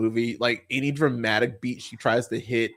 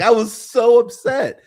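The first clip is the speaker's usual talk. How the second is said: ordinary talk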